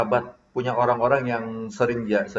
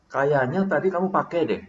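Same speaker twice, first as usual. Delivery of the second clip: casual, unaccusing reminder; merely remind, tentatively; easy suggestion in an everyday pitch; gentle reminder. Shown as a demonstration. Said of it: "Mengingatkan, sekedar mengingatkan".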